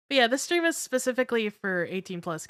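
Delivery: ordinary delivery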